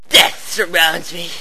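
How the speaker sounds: ordinary talk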